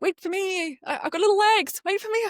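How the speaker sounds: mock little-girl plea to keep up; high whiny plea. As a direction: whine up high like a kid left behind. Pleading with fast-walking husband.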